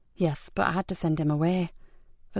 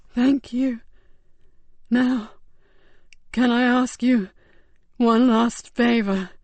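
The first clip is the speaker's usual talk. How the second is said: higher, slow, strained dying voice; weak dying man; 3 semitones higher, 3 dB louder, slower than usual. Acted. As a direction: barely hold on to each word.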